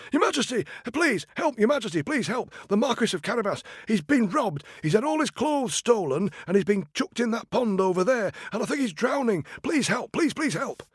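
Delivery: high, urgent cry for help; frantic plea. Acted. Cat stopping the king's carriage, high and frantic. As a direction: flag down the carriage in a panic.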